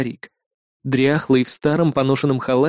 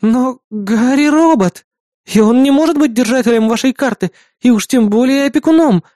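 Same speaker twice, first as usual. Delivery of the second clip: bewildered; 8 semitones higher, 4 dB louder than usual. Acted. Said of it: "растерянно выдохнула напарница".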